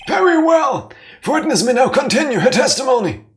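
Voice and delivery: high, loud, fast judge voice with a laugh; grand mock judge, barely holding back a laugh; hammed-up judge. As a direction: boom it out like a pompous judge. Plays the judge with gusto.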